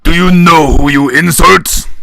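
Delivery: put-on drunken yell. A narrator's reading. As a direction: bellow across the bar. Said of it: "Shoji yells across the bar".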